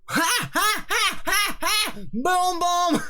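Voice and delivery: very high, loud fox puppet laugh; Basil Brush voice. Acted. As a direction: cackle it like a cheeky fox puppet. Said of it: "That was a lot closer".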